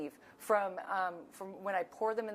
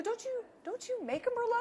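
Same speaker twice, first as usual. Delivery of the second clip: much higher, mock-innocent voice; teasing question. [name] teases a rival winemaker buying her Merlot, high mock innocence. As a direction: needle the rival winemaker playfully.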